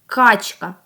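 "Качка" with a loud, hard opening К; first sound stressed. Shown as a demonstration. Teacher asks for the very first sound, opening К in "Качка" punched. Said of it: "Що ви найперше чуєте".

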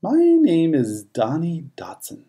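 ordinary delivery

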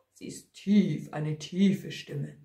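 low, half-whispered deep tone. Shown as a demonstration. Narrator explains the voice is deep, low-voiced. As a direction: sink down low on "tief".

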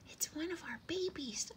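high, breath-filled sparrow excitement; thrilled little bird, half-whispered; excited cry. A narrator's reading. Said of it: "cried the shy little sparrow excitedly".

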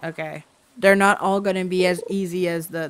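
usual speaking voice

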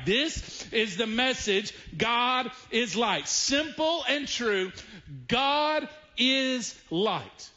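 slow, deliberate stress on God; preacher hammering home God is light; emphatic and slow. Acted. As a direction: land each word of the key point. Pastor repeats the key note.

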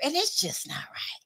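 whispered, conspiratorial aside. Real, not acted. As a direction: drop to a whisper, as if sharing a secret.

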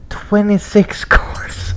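stretched, high amazed whisper. Real, not acted. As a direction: whisper it slowly in disbelief. Amazed at the car count.

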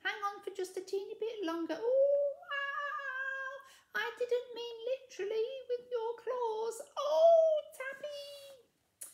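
put-on high, strained girl's voice, pleading; Mildred in distress. A narrator's reading. Mildred begging her cat to hang on, high and strained. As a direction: soothe the cat while panicking.

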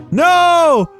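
loud, high cry of frustration; long howling protest; dismayed shout. Real, not acted. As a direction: yell it as everything fails. Falls again in the game.

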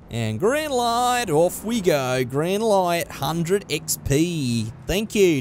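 drawn-out put-on Australian accent; amateur Australian twang; mock Australian. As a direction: stretch the words in a hammed-up Aussie accent. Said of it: "Don't know why I'm suddenly Australian".

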